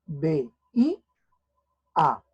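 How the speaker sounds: "B I A" with each syllable set apart by a pause; "B I A" read as separate syllables with gaps; spelled out by syllable. Shown as a demonstration. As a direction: give each syllable of "B I A" its own beat. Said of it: "Veía se separa así".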